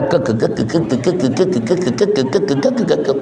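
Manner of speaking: low, rapid staccato "ke-ke-ke"; mimicked ticking, quick and even; imitated fan rattle. Imitating a shaking ceiling fan's noise.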